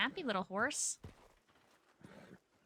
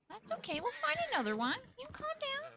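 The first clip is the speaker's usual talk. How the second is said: cooing. Real, not acted. Cooing at the horse.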